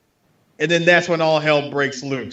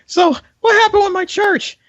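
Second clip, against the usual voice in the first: high mock priest. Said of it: "set up the freeze frame".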